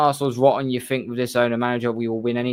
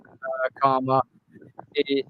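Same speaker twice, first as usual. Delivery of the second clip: normal talking voice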